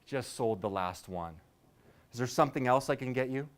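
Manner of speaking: a hint of an apologetic server; polite waiter. Waiter answering the customer.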